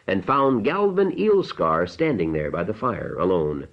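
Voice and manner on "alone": plain reading voice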